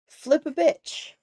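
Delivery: ordinary talk, nothing marked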